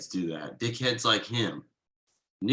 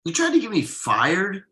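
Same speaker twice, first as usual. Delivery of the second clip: angry; 9 semitones higher, 4 dB louder than usual. Acted. Confronts friend about betrayal.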